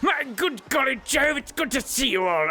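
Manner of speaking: high hearty shouted greeting; old-fashioned jolly hail to friends; jolly exclaiming figure. Acted. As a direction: bellow the greeting gladly. Character greeting loudly.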